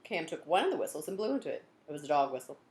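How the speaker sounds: ordinary delivery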